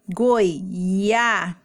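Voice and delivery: GOIABADA slowly, goi-a-ba-da with long gaps; syllable by syllable. Shown as a demonstration. Teacher dictating syllables to copy, slow goi-a-ba-da for GOIABADA. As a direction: dictate GOIABADA one drawn-out syllable at a time. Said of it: "copiar a primeira sílaba".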